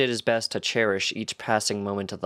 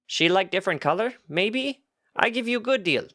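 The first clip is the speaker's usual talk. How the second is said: pushy goat merchant; 11 semitones higher than usual. A narrator's reading. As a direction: hawk the scarf in broken words, pitched up.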